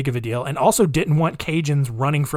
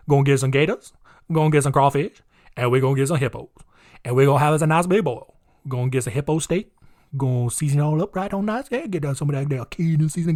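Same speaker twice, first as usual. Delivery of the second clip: put-on Cajun accent; faster than usual. Mocking Cajun locals, in a put-on Cajun accent. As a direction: play a Cajun boiling hippo on the bayou. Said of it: "I love my Cajun accent".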